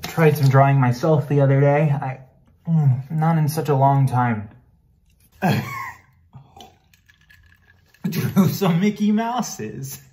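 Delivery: loud, high-pitched burst of pride; excited boasting. Acted. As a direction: show off eagerly, voice lifted high. Boasts to wife of own drawings.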